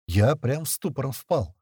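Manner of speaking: usual speaking voice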